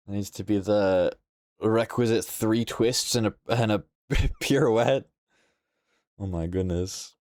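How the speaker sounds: ordinary delivery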